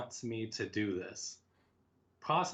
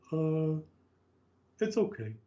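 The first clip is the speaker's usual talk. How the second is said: higher, hesitant mock reply; sheepish mock answer; 8 semitones higher, 5 dB louder than usual. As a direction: sheepishly fake it's fine. Quoting own lame answer.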